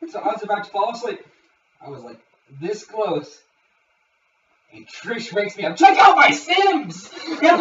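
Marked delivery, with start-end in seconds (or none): 5.8-7.1 slow, high, loud mock shout; hammed-up blaring announcement; imitated excited yell. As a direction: imitate waking a friend with big news. Friend suddenly waking the teller.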